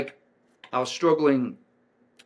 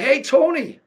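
much higher, louder starstruck hello; eager greeting; 10 dB louder than usual. Retold or imitated. Speaker answering the sales rep's call, starstruck greeting. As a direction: greet him like your hero just called.

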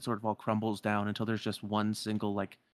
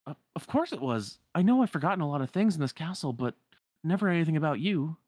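higher-pitched, emphatic sincerity; earnest young hero; 7 semitones higher, 5 dB louder than usual. Acted. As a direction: insist that you could never forget them. Voices Sora reassuring Namine.

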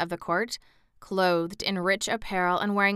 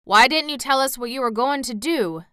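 reproachful; 5 semitones higher, 6 dB louder than usual. A narrator's reading. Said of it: "inquired Uncle Henry reproachfully".

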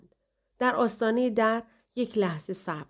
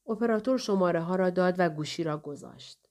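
ordinary delivery

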